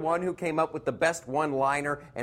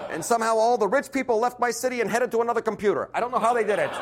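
Bernie Sanders voice; 7 semitones higher than usual. Pretend.

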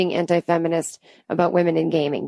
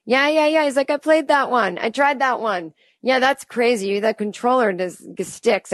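yelling across a party; 5 semitones higher than usual. Retold or imitated. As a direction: call out like shouting between rooms at a party. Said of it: "yelling out the door like he's going".

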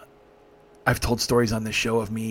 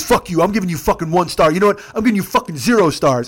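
loud, raised rant as the passenger; retold petulant anger; 6 semitones higher, 6 dB louder than usual. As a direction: threaten the driver with spiteful outrage. Passenger threatens bad rating, retold.

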